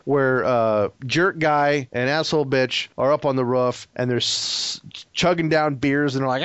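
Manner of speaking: ordinary talk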